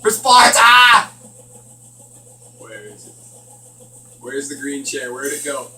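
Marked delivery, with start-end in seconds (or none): 0.0-1.1 slow, loud, high war cry; battle cry. Pretend. Gamer charging the monster, mock battle cry. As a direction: roar it like a charging Spartan.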